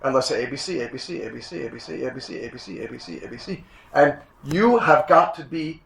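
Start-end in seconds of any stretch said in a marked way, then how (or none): 0.4-3.6 repetitive chant fading much softer; a child's verbal stim, fading out; scripting demo. Shown as a demonstration. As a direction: loop the letters and let them fade away. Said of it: "it's called scripting".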